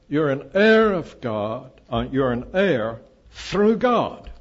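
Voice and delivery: slow and loud, weight on "heir" and "God"; emphatic reading. Shown as a demonstration. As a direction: lean slowly on "heir", then land on "God". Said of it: "Emphatic, emphatic position".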